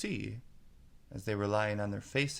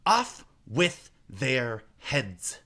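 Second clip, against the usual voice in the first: slow, louder, drawn-out verdict; sentencing queen; 4 semitones higher, 8 dB louder, much slower than usual. A narrator's reading. Queen sentences the gardeners to death. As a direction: pronounce the doom.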